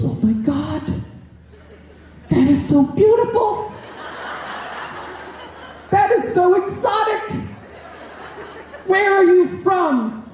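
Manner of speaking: high, gushing, sing-song wine mom voice; gushing sub. Retold or imitated. Negligent sub gushing over the student's exotic name, high and sing-song. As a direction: coo it like a clueless suburban mom. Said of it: "She goes".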